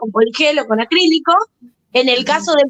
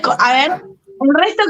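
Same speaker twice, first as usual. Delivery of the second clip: usual speaking voice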